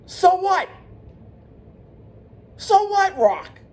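high-pitched indignant "so what"; outraged challenge. Real, not acted. Fan calling out The Rock, high and indignant. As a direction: fling the question at him in disbelief.

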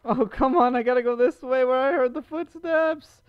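yelling in dread at having to go on; loud frightened protest. Real, not acted. Forced toward the footsteps.

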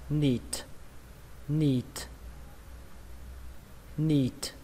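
"neat" with a long ee and puffed final T; long vowel, released T. Shown as a demonstration. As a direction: stretch the ee in "neat", finish on an airy T. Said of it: "âm y dài và các bạn kết thúc bằng âm T".

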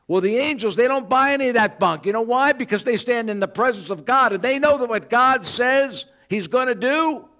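high, loud fervent sermon; preaching at full force. Acted. Preacher rousing congregation about Gabriel, fervent and loud. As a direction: preach it with fire.

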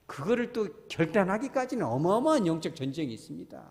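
normal talking voice

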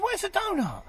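"Where's the donut?" pitched high like a baffled crab. Acted. Crab asks his friend where the donut went, voiced high.

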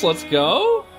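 ordinary talk, nothing marked

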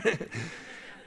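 breathy little laugh at the memory; amused airy chuckle; soft laugh. Real, not acted. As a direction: let a quiet laugh slip out. Laughing at his own story.

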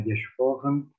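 normal talking voice

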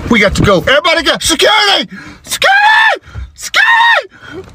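urgency climbing into a squealed shout; panicked shouting. Acted. Dad clearing store and calling security, panic building. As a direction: call for help, building to a shriek.

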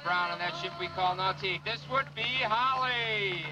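booming showman announcer. Acted. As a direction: sell it to the crowd like a showman. Announcer introducing skiers.

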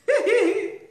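high, loud burst of laughter. Real, not acted. Host laughing about artisans, high and loud.

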